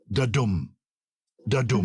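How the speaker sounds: "Da-dum" with a light "Da" and a stressed "dum"; iambic stress. Shown as a demonstration. Host demonstrating an iambic foot, "Da-dum" stressed on "dum". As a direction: lean on "dum" after an unstressed "Da". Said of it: "an unstressed syllable followed by a stressed syllable".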